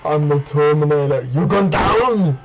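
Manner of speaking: mock Terminator voice, rising on the threats; Arnold. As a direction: do a hammed-up action-robot threat. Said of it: "in the Arnold voice".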